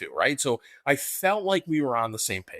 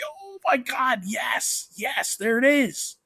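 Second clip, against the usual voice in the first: high, thrilled squeal; excited recognition; 14 semitones higher, slower than usual. Real, not acted. Host spotting Sheik autograph on photo, excited high squeal.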